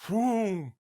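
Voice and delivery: high, loud whoom of the ride dropping; whooshing drop noise. Retold or imitated. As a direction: sound out the sudden plunge. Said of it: "you just go".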